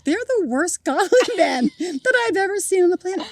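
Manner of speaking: high voice breaking into laughter; laughing. Retold or imitated. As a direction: say it while cracking up. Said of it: "we started laughing so hard".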